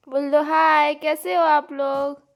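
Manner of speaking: put-on high, loud, slow sing-song coaxing the baby to say hi; baby talk. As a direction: stretch each word out for a toddler to copy. Prompting baby to greet viewers. (बच्ची को सिखाते हुए ऊँची, धीमी तोतली आवाज़)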